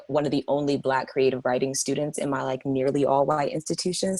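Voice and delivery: normal talking voice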